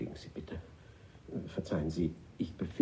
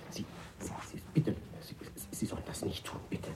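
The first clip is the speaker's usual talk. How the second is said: panicked whisper; 4 semitones higher, 6 dB quieter than usual. Acted.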